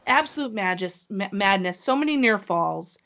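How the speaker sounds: usual speaking voice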